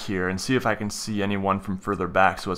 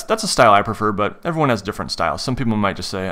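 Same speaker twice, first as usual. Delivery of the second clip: usual speaking voice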